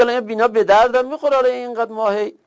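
student griping, voiced high and fast; mock student. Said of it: "میگه".

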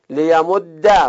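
لیمد slowly, doubled d ending in "a"; fatha ending. Shown as a demonstration. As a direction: say لیمد as le-ya-mod-da. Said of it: "فتح اخف حرکات". (لیمد با دال مشدد و فتحه)

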